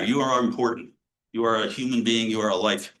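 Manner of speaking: usual speaking voice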